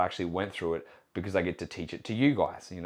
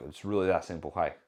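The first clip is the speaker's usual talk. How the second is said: usual speaking voice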